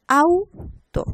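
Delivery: Auto split as AU, pause, TO; high, loud AU set apart in Auto; syllable by syllable. Shown as a demonstration. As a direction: break Auto into two separate syllables. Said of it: "que componen una sílaba".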